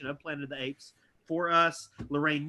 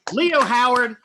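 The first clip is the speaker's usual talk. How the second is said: raised, loud announcer call; big curtain-call introduction; announcer roll call; 7 semitones higher, 10 dB louder, slower than usual. Real, not acted. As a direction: present the performer to applause. Host's roll call of the cast.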